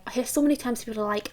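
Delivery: ordinary delivery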